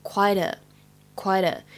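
"quite a" slowly, the t linked into a; linked pronunciation. Shown as a demonstration. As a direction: run "quite a" together as one word. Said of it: "后面的这个quite和a可以连读". ("quite a"慢速连读示范)

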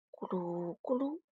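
tummy rumble. Acted. Stomach growling sound.

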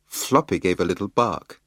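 usual speaking voice

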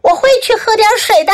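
high, quick answering call; yelling back. A narrator's reading. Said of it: "我也喊回去".

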